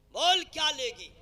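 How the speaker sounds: loud, high demand, hammed up; mock-angry shout; exasperated husband. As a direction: play a husband shouting an offer to stop tears. Acts husband pressing crying wife.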